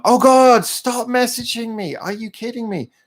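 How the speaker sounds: high, exasperated outburst. Real, not acted. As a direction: plead in disbelief at being pestered. Pestered by messages while live.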